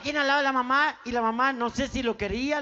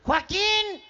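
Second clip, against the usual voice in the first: raised, drawn-out call for her son; mother calling; 7 semitones higher than usual. Acted. Mother calls her son Joaquín, high calling voice. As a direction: call the boy from another room, high. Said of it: "La mamá lo llama".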